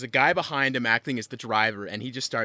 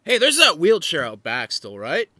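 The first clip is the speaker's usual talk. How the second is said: louder, higher party shout-out; partygoer calling out; 5 semitones higher, 10 dB louder than usual. Retold or imitated. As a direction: call out a sudden bright idea to friends. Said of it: "someone said".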